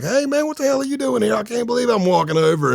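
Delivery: sudden high-pitched surprised greeting, hammed up; acted surprise. Pretending to be caught off guard as the car arrives, high and surprised. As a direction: play shocked to see your buddy drive up.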